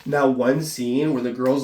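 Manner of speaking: normal talking voice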